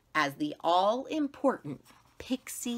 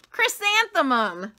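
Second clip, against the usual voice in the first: put-on higher, louder teasing kids; teasing answer; 11 semitones higher, 11 dB louder than usual. The girls answering the teacher, high and mocking. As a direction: call it out like mocking schoolgirls. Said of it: "they answered".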